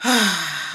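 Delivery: unimpressed acted sigh; weary little exhale. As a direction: sigh as if already fed up. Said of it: "I'm already looking like".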